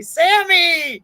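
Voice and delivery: hailing a viewer by name; calling out. Real, not acted. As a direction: call the name out with delight.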